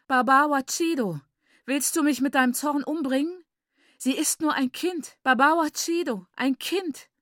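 high, distressed lament; wailing plea. A narrator's reading. Mother begging for her child, high wailing. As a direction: wail to him to spare the child. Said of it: "jammerte Maiguru".

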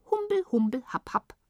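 very high silly babble, dropping into short syllables; silly gibberish. A narrator's reading. Tibetans answer the question about the weather, silly high gibberish. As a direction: chirp nonsense in a put-on high voice. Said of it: "Die Tibetaner grinsten albern".

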